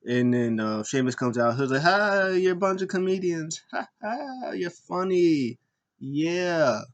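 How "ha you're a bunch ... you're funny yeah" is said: high, drawn-out fake laugh and sneer; mock sarcastic amusement. Mimicking Sheamus answering his mockers, sarcastic fake amusement. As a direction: pretend they are hilarious.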